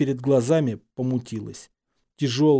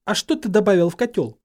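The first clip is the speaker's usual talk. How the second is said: raised, higher, cross voice; heated, higher-pitched question; angry cry; 7 semitones higher, 4 dB louder than usual. A narrator's reading. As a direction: demand it angrily. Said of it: "со злостью выкрикнула Гермиона".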